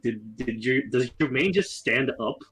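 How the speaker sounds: ordinary talk, nothing marked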